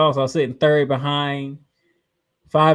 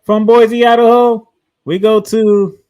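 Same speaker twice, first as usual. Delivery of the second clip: hyped announcer; 8 semitones higher, 12 dB louder than usual. Acted. Show host announcing next location.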